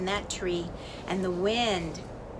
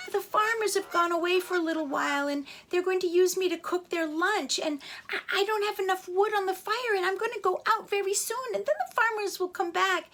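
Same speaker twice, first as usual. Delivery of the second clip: high, begging fire voice; a fire pleading before it goes out; 10 semitones higher than usual. A narrator's reading. Fire begging the little chick, high and pleading.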